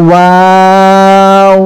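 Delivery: ওয়াও held very long with rounded lips; ওয়াও গোল মুখে টেনে; rounded drawn waw. Shown as a demonstration. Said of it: "মুখটা কিন্তু গোল হচ্ছে".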